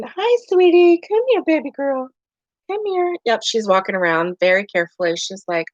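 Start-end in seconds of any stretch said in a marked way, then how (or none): 0.0-3.2 high, sweet coaxing to a scared cat. Real, not acted.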